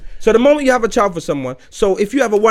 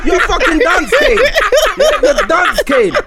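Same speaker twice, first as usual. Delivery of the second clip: laughing; 8 semitones higher, slower than usual. Real, not acted. Laughing insults.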